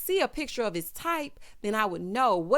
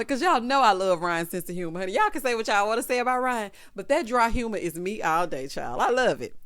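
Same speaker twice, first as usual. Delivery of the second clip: ordinary talk, nothing marked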